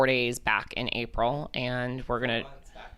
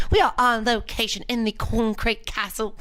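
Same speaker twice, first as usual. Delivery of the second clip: higher, hammed-up fake British accent; 7 semitones higher, 3 dB louder than usual. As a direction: put on a bad British accent. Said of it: "my fake British accent".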